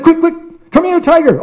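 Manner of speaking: very high, urgent squeal; panicked dog. Acted. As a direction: call out in a frantic hurry. Dog calls to yogi in panic.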